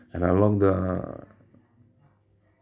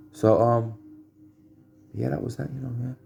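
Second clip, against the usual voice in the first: plain reading voice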